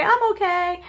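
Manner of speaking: put-on high, perky kid's reassurance; chirpy child. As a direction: bounce back up proudly. Child jumps up after falling.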